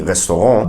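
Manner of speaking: RESTAURANT with French r and nasal ending; French pronunciation. Shown as a demonstration. Teacher shows the French original, French pronunciation. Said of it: "In French".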